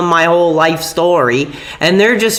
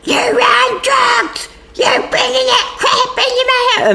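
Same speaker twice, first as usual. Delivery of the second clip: mock screaming mom. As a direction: shriek it like a raging mom. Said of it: "ranting and screaming".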